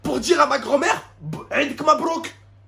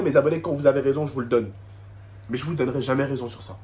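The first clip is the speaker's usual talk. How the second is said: usual speaking voice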